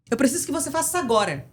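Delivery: rapid, insistent demand; urgent order. Shown as a demonstration. Doctor to nurse in a conflict on duty, urgent order. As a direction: fire it off quickly as a command. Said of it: "Cada uma teve uma entonação".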